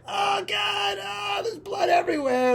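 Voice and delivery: high shouted horror; mock screaming victim; hammed-up panic. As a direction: yell it as the victim bleeding. Victim bleeding, acted.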